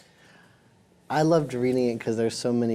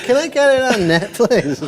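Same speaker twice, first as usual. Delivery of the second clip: laughing self-mockery; 12 semitones higher, 9 dB louder than usual. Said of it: "I was like".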